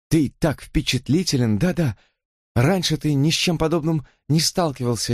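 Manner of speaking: high, pleading sister voice; earnest sister. Acted. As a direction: plead with a troubled brother. Susan voiced in the story.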